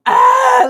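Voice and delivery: high, loud scream of bug panic; panicked scream. Retold or imitated. Wife thinks bugs are on her, high re-enacted scream. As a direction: let out a short, high re-enacted shriek. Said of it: "I'm like".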